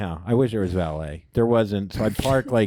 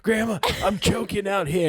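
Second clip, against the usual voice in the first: panicky; 11 semitones higher, 3 dB louder than usual. Retold or imitated. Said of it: "I kinda was".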